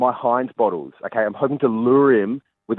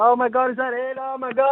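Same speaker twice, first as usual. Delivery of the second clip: much higher, breathless fake excitement; mock surprised gasp; 12 semitones higher than usual. Field reporter pretending he has spotted Ed, hammed-up surprise. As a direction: gush like he's just spotted the target.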